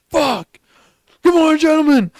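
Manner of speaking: high, loud recruit bark; barked sir yes sir. Retold or imitated. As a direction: scream it at full force like a recruit. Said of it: "And you're like".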